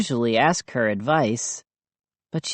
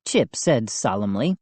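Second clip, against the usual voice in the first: usual speaking voice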